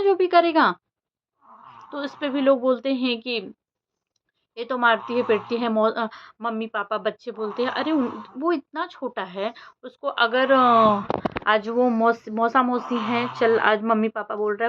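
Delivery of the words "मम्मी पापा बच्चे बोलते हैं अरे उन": ordinary talk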